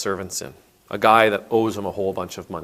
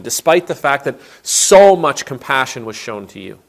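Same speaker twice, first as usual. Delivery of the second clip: angry master; 3 semitones higher, 6 dB louder than usual. Retold or imitated. Angry master condemns servant.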